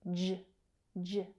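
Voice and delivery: "J, j" as bare consonant sounds, juh, juh; isolated J sound. Shown as a demonstration. Teacher isolating a tricky consonant, "J, j" said as juh. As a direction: voice a short "juh" for each "J". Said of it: "That consonant sound is tricky".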